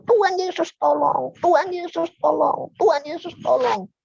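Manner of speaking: high pleading cry through the pain; desperate cry for help. Retold or imitated. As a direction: beg Jesus for help, voice pitched high. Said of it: "saya katakan". (seruan minta tolong dengan suara tinggi kesakitan)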